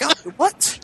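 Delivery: high, hesitant mock confusion; baffled. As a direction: retell a puzzled double-take. Retelling his baffled answer.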